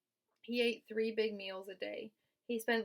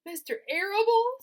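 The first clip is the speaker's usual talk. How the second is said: sobbed; 13 semitones higher, 14 dB louder than usual. A narrator's reading. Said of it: "sobbed Wilbur".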